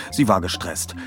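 ordinary delivery, nothing marked

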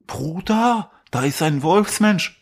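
hoch und aufgeregt, gedehnt; TikTok-Livestreamer. Retold or imitated. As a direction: aufgeregter Streamer, übertrieben. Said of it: "TikTok live". (aufgeregter TikTok-Streamer)

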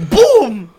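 loud mock boom of a crash; telling how he hit the car, loud boom; crash noise. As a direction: slam it out like the impact. Crash in the story.

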